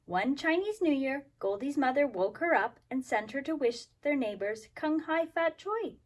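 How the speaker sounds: ordinary delivery, nothing marked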